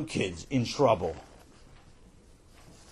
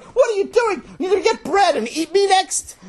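high-pitched yell of outrage; loud, very high, alarmed boy; panicked protest; 8 dB louder than usual. Acted. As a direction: shriek the protest as a horrified kid. Sammy protesting in the story.

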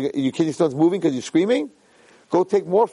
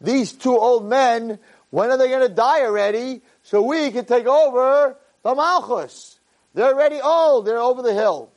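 scheming son; 7 semitones higher than usual. Acted. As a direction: sneer about the old men waiting for them to die. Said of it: "and Nadav said to Avihu".

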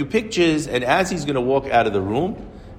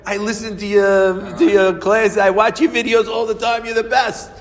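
high, loud, thrilled praise; retold gushing fan; 10 semitones higher, 5 dB louder than usual. Owner praising the rabbi's classes, high excited gushing. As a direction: gush like a starstruck admirer.

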